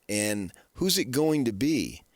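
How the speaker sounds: ordinary talk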